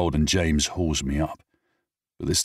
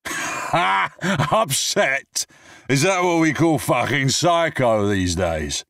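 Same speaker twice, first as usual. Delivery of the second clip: high, loud, scornful slurred jeer; mock it drunk and bitter; drunken scoff; 11 semitones higher, 5 dB louder, slower than usual. Drunk man scoffs at James about Rose, high jeering.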